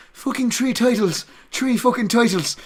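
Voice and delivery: high, loud mock Irish accent. Shown as a demonstration. Comic comparing how the Irish say three, exaggerated Irish accent. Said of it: "the same way the Irish do".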